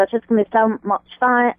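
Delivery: light mock Australian accent; a quick playful Australian put-on; half-hearted Australian voice. Shown as a demonstration. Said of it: "Or that's my Australian".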